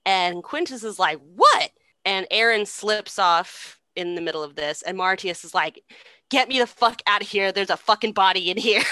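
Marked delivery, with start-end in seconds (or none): 1.2-1.7 loud, mock-alarmed call; startled shout. Brother calling down into the pit, loud puzzled shout. 6.3-8.8 mock panic. Man stuck in a pit with a body, mock panic.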